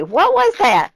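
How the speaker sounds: high, amazed "What was that?"; surprised question. Pretend. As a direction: pretend viewer gasping in disbelief. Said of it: "You're gonna say".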